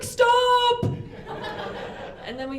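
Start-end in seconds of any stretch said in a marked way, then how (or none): 0.0-0.8 high, loud yelled Stop; mock shout. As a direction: yell it out to break up the fight. Sees guy being beaten.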